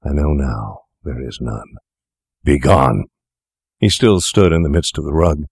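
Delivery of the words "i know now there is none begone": low, grave verdict turning to a firm command; bitter dismissal. A narrator's reading. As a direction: sink low, then cast him out. Fettes bitterly dismisses his old associate.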